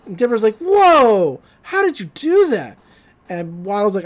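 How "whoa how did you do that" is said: high, loud gasp of wonder; amazed Dipper. Retold or imitated. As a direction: burst out amazed. Dipper amazed by solved puzzle.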